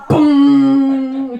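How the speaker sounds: loud punchy announcer boom; news promo boom. Acted. Announcer parody punching the word boom. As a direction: hit it like a TV promo.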